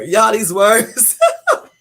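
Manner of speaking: cracking up, high and loud; laughing hard. Real, not acted. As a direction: squeal the words through real laughter. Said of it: "I'm laughing because".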